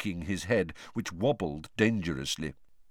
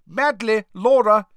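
calling out names; 14 semitones higher, 11 dB louder than usual. Acted.

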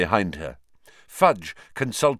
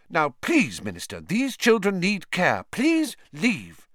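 firm nurse; 9 semitones higher than usual. Acted. Nurse shooing visitors out.

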